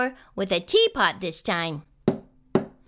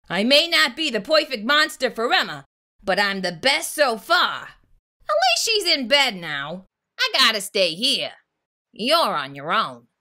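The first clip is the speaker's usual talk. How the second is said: growling Gabe; 2 semitones lower than usual. A narrator's reading. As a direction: growl it out, firmly final. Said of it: "Gabe growled".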